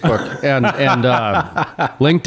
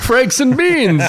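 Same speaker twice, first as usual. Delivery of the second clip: laughing; 11 semitones higher, slower than usual. Real, not acted. Punchline said through laughter.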